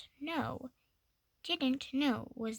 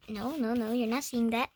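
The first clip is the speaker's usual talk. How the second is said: ordinary delivery, nothing marked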